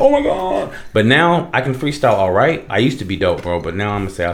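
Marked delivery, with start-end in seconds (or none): 0.0-0.7 much higher gasp of awe; mock amazed partygoer. Party crowd reacting to fast freestyle, mock awe. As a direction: act floored by fast rapping. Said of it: "they be like".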